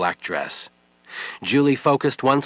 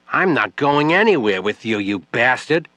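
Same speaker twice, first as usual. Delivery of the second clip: furious, higher and louder; angry shout; 4 semitones higher, 5 dB louder than usual. Acted. Grieving widow cursing Webb, furious shout. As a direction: spit the insult at him.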